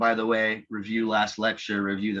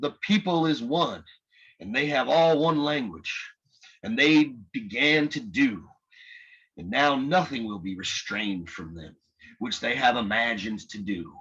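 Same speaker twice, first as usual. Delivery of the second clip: put-on Southern accent; 3 semitones higher than usual. Lecturer voices a reverend's sermon to the class, in a Southern accent. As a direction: intone it like a Southern revival preacher. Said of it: "I'll just try my Southern accent".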